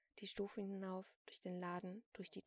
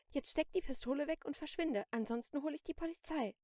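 raised, loud, forceful order; indignant warning; 7 semitones higher, 9 dB louder than usual. A narrator's reading. Shopkeeper warns the armed Celine off, loud and indignant. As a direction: tell them off, higher and louder.

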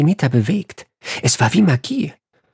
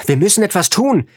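high-pitched urgent shout; desperate yell for action; 8 semitones higher than usual. A narrator's reading. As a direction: cry out in panic as the door is attacked. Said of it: "schrie ich".

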